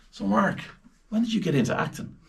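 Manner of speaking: high, polite interviewer voice; interviewer's question. Acted. Interviewer asking a film star a stock question, high-pitched. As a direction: pitch it up like a chirpy press question.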